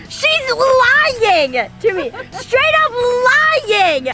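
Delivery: high, very slow outraged drawl; stretched-out indignant tattling; dramatic accusation. Real, not acted. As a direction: drag it out like a scandal. Accusing mom to camera.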